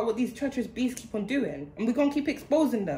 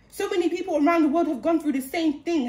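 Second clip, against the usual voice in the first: ordinary talk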